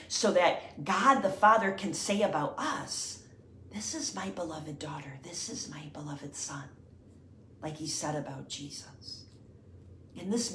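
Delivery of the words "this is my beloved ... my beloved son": soft tender whisper; hushed voice of God. Acted. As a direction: whisper it like a loving blessing. God the Father speaking about us.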